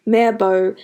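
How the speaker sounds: ordinary delivery, nothing marked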